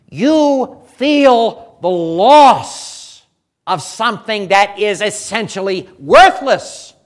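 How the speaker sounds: loud, insistent pulpit hammering; preacher thundering at Jonah; full-force preaching. Acted. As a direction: drive each line home to the congregation.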